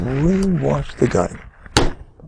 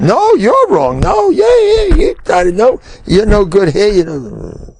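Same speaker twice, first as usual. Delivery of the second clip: high, squealing parody of an argument; hammed-up high-pitched quarrel; mock squabbling; 11 semitones higher than usual. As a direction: imitate a childish spat, pitched way up. Mimics squabbling couple.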